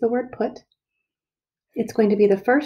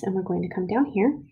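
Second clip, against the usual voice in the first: normal talking voice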